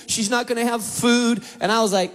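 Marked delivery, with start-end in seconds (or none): none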